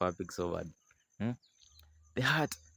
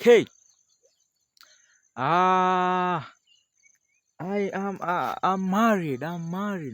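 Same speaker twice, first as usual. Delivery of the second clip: mock high-pitched voice; 12 semitones higher, 11 dB louder, much slower than usual. Guy's high-voiced retold line.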